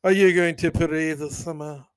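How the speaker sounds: snobby mock French Paris. Shown as a demonstration. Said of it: "That's how the snobs say Paris".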